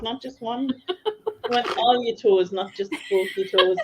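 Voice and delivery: ordinary talk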